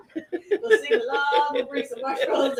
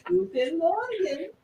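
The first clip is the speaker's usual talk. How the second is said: German greeting; 6 semitones higher, slower than usual. Pretend. Friends trading German phrases.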